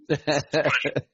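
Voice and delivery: slow, lightly put-on Scottish accent. Shown as a demonstration. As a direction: drawl it in a weak Scottish accent. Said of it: "I've got a terrible Scottish accent".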